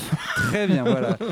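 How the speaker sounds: high, loud laugh through the words; giggling wrap-up. Real, not acted. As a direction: laugh it off, embarrassed at the slip. Laughs at own English accent.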